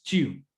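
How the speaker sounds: chiu with a puff of air on the t; spat t. Shown as a demonstration. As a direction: chiu as if spitting. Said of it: "como si escupiéramos". (chiu escupiendo la T)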